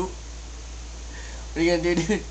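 breathless giggly whisper; laughing whisper. Real, not acted. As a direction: whisper while stifling laughter. Friend whispers through laughter.

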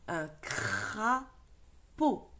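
crapaud stretched out, very slow; slow model reading. Shown as a demonstration. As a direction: read un crapaud slowly, syllable by syllable. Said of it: "models word syllable by syllable". (lecture très lente : un crapaud)

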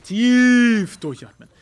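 slow, drawn-out self-calming; steadying his nerves before the objective; deep breath. Real, not acted. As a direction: breathe it out slowly to calm down. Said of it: "ich bin so nervös".

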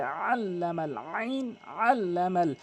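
slow আল্লামাল with a throaty ain from mid-throat. Shown as a demonstration. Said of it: "আইনটি হলকের মাঝখান থেকে".

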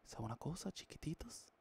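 quiet voice sliding into a whisper; soft ASMR whisper. Pretend. Streamer drops into a soft whisper for fans. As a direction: hush it down to a whisper. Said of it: "Voy a hacer una S M R".

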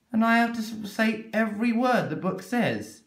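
a reader whining about the rule; whiny protest. Acted. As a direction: protest like a put-upon grown-up.

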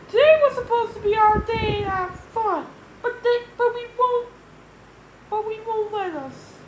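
high, loud whine of protest; George complaining. Acted. George sent away on his fun day, complaining high and loud.

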